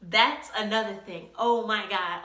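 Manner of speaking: ordinary delivery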